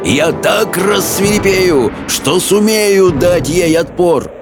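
roaring on. A narrator's reading. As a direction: carry on the high, emphatic roar. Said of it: "проревел Хоул". (горячая тирада на повышенных тонах)

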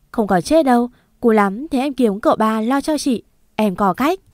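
usual speaking voice

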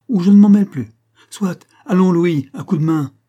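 high, loud, commanding burst; threaten, then call the servant over; forceful ultimatum. A narrator's reading. Men moving to evict the agent, forceful ultimatum.

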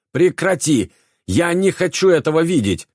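high, loud desperate scream. A narrator's reading. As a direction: scream it out in panic. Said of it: "завопила она".

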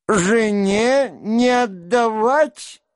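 put-on high, drawn-out old prince; slow high-pitched old man's retort; old man about to laugh. A narrator's reading. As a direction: stretch it out slowly, pitched high. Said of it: "сказал старик и засмеялся".

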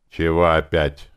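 put-upon and annoyed; displeased. A narrator's reading. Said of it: "недовольно отозвалась она".

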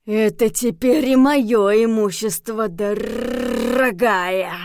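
slow, drawn-out menacing growl; werewolf growl. A narrator's reading. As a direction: growl it with a rolled, stretched last word. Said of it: "прорычал оборотень".

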